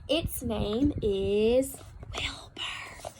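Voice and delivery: slow, breathy whisper to herself. A narrator's reading. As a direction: whisper the name slowly, lovingly. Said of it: "she whispered to herself".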